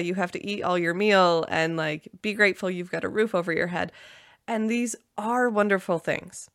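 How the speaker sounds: usual speaking voice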